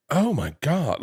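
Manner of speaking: high, gushing mock "oh my God"; mock gasp of the new girlfriend. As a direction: gush it like someone dazzled by sudden money. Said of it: "and then is like".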